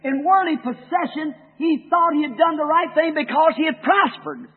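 high, loud, rolling pulpit cadence; preaching at full force. Acted. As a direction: thunder it at the congregation.

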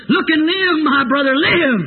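high, exclaimed hymn words; fervent exhortation. Real, not acted. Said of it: "As the song says".

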